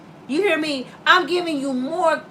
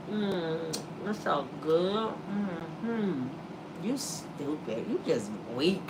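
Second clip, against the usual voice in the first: low, slow, hushed temptress purr; sultry temptress Eve; 9 semitones lower, 13 dB quieter, slower than usual. Retold or imitated. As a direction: lure him in, low and quiet. Eve tempting Adam with fruit.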